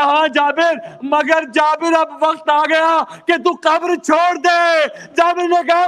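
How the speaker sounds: rising, shouted mourning oratory; impassioned majlis preaching. Acted. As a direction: preach the grief out at full force. Majlis preaching of Jabir at Karbala. (پرجوش مجلس خطابت، بلند آواز میں)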